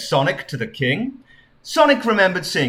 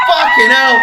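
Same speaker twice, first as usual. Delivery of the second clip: startled shout; 9 dB louder than usual. Real, not acted. Startled by a donation alert.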